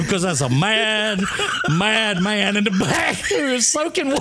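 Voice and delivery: high, slow, laughing through it; can barely get it out for laughing; laughing hard. Retold or imitated. Telling his mother about the soaked man, laughing helplessly. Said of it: "I said".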